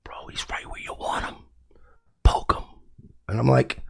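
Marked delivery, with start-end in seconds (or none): 0.0-2.6 conspiratorial whisper, almost no voice; whispered advice. Retold or imitated. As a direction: whisper it like a sly tip. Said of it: "I've had a lot of people say that to me".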